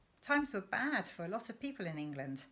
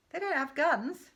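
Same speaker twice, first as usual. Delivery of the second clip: alarmed protest; 8 semitones higher, 8 dB louder than usual. Acted.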